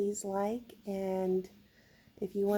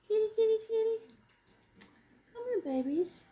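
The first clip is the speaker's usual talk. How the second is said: kitty call; 10 semitones higher, faster than usual. Real, not acted. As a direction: call the cats high and bright. Calling cats.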